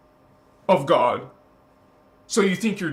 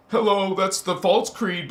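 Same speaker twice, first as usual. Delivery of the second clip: usual speaking voice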